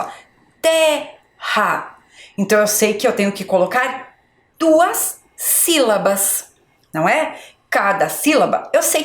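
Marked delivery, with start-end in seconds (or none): 0.6-2.0 "Terra" in two separate held beats, TER, RA; split into syllables. Shown as a demonstration. Teacher counting with a finger, "Terra" syllable by syllable. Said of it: "o dedo, ó, pra ficar mais fácil". 4.6-6.5 "duas sílabas" stretched and measured; slow emphasis. Shown as a demonstration. Teacher giving pupils the count, slow and emphatic.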